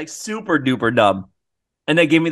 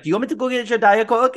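put-on Spanish accent; 8 semitones higher than usual. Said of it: "she's Spanish".

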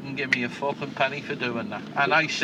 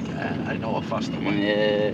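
lower, slower put-on passenger voice; imitating the passenger; 8 semitones lower than usual. Taxi driver mimicking his know-it-all passenger, low and slow. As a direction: drop low and slow, mocking the fare.

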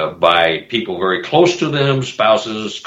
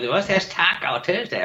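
put-on Minnesota accent; 8 semitones higher, 6 dB quieter than usual. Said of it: "your Minnesota accent".

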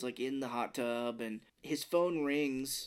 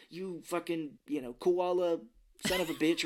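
higher, halting fake insult; mock fighting; 5 semitones higher, 3 dB louder than usual. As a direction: grope for a staged insult. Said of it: "act like we're fighting".

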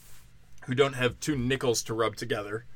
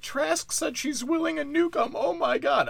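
pitched way up, gasping mock panic; mock panicked Brits. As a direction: shriek out the news like a scandalised Brit. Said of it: "They're like".

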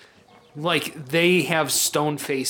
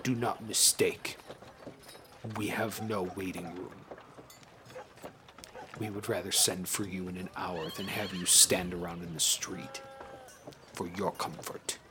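rumbling voice; 6 semitones lower, 10 dB quieter than usual. Acted. Short guard answers the party.

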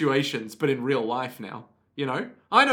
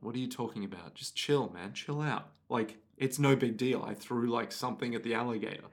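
quiet, offhand brush-off; dismissive; 4 semitones lower, 8 dB quieter than usual. Retold or imitated. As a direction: wave it off like it's nothing. Jolene dismissing Anasui.

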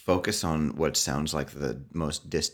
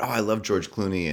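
gushing fan voice; 5 semitones higher than usual. Retold or imitated. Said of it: "other people talking about".

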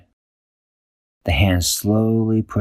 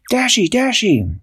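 high, slow calls of her name; calling through wall; 14 semitones higher than usual. Acted. Friends calling Dashy through the wall, high urgent calls. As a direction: call out to a friend behind a door. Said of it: "They said".

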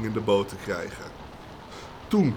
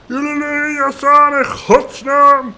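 much higher and louder, slow, far-carrying; bellowing boatsman; 11 dB louder than usual. Acted. Boatsman yelling orders at his crew, loud and high. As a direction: roar it across the beach. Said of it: "zei de bootsman".

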